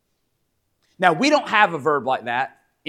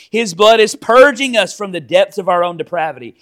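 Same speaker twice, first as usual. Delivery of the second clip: raised, driving pulpit voice; fervent preaching; 5 semitones higher, 7 dB louder than usual. Acted. Pastor preaching to the congregation, fervent and raised. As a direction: preach it with rising force.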